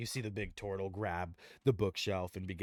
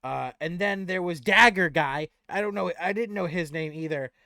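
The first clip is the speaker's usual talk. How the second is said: higher, loud goblin voice; goblin recalling names; 10 semitones higher, 13 dB louder than usual. Acted. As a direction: think aloud as a helpful little goblin.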